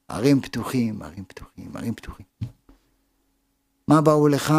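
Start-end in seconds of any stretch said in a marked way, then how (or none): 0.1-2.3 low voice dropping away to very soft; fading repetition. Real, not acted. Preacher tells listeners where the missiles fell, voice fading away. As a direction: let each repeat sink lower, almost to nothing.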